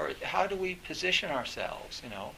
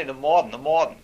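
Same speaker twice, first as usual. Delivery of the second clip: "the mawd'n", louder, in imitated New England accent; 10 dB louder than usual. Lecturer mimics professor repeating himself, thick New England accent. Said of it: "you might hear him say".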